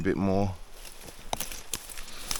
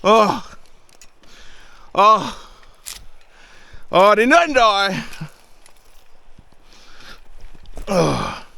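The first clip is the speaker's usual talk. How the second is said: high loud relieved exclaiming; amazed to be alive; 11 semitones higher, 6 dB louder, much slower than usual. Real, not acted. Relief after dangerous drop.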